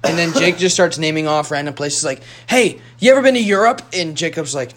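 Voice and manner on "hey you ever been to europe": retold argument. Acted quote of heated argument.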